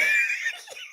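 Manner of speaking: high squealing burst of laughter; sudden high-pitched laughing shriek. Real, not acted.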